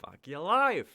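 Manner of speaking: loud high yell of mock despair; joking shout. Real, not acted. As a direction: bellow it out as a joke. Shouted joke after laughter.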